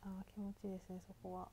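soft whispered sigh of relief; relaxed whisper. Real, not acted. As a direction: breathe it out in bliss. Relaxing massage spot.

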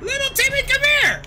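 put-on high, loud sing-out like calling a child; mock parental call. Streamer calling an enemy over like a parent calling a child. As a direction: holler for the kid to come over.

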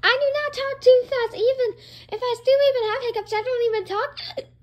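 high, loud defensive rant; indignant protest. Acted. Tracker denying talking too fast, high indignant protest. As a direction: protest loudly, pitched right up.

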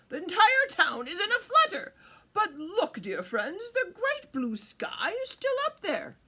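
loud, high, swooping regal voice; crowing king. A narrator's reading. King asking about the falling sky, loud crowing. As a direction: proclaim it like a crowing monarch. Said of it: "crowed the king".

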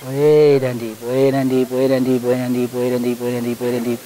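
low, slowing, fading hypnotist chant; hypnotic deepening. Acted.

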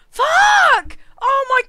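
high, loud burst of swearing; loud cry of dismay; anguished shout. Real, not acted. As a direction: explode in real frustration. Outburst after regret.

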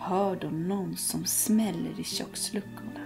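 ordinary delivery

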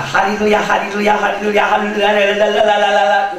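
rapid monotone chant dissolving into babble; mock tongues demo. Shown as a demonstration. Preacher mock-teaching the congregation to speak in tongues, droning repetitive chant. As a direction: repeat it mechanically until the tongue slurs. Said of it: "来，我教教你方言".

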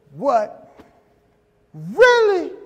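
high, loud startled Really; surprised. Retold or imitated. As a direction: jump up in disbelief. Surprised at wife's news.

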